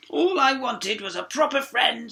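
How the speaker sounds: high, slow, loud wail; sobbing frog. A narrator's reading. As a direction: sob it out as the frog. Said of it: "sobbed the frog".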